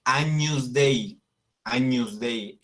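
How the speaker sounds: agnus Dei said "A-ñus DE-i"; Latin pronunciation. Shown as a demonstration. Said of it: "se dice agnus".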